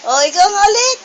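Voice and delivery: put-on high, playful prompting of a baby; high-pitched baby-talk coaxing; excited coaxing. Shown as a demonstration. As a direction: egg the baby on, squealing Agi. Coaxing baby to copy the squeal.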